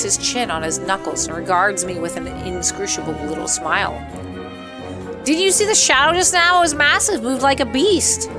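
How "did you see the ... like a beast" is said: high, urgent acted panic; acted breathless alarm, much higher in pitch; alarmed questioning. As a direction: blurt it out high, as a rattled witness. Acts startled character's question.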